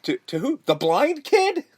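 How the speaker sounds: stammered question leaping up at the end; mock disbelief. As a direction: act out stunned incredulity. Acting out shocked reaction in film.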